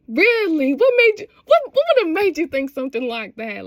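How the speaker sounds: high, loud mock astonishment; hammed-up shocked questioning; retold disbelief. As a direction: act out incredulity, starting high then settling.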